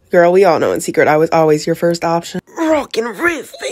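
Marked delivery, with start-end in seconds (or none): 2.6-3.7 very high, loud laugh-shout; wild laughing shout. Pretend. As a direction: yell it like a rocker.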